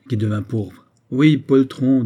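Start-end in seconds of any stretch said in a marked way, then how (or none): 1.1-2.0 loud, higher confirmation of the taunt; raised-voice jeer; insistent insult. Acted. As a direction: throw the insult back forcefully. Grenadier flings the insult back.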